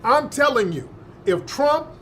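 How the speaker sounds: usual speaking voice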